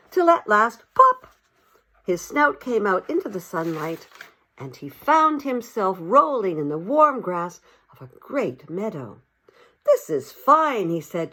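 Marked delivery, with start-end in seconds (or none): 0.9-1.3 a sudden high pop like a cork; bursting pop. A narrator's reading. Narrator as mole's snout bursts into sunlight, high pop. 9.8-10.9 higher, slow, savouring delight. Acted. Mole rolling in warm grass, slow delighted sigh.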